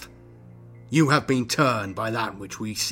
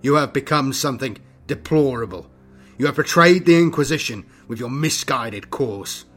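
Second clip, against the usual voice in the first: louder, higher, emphatic denunciation; forceful condemnation; 4 semitones higher, 10 dB louder than usual. A narrator's reading. Inquisitor accusing his fellow of betrayal, forcefully condemning. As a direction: condemn him outright.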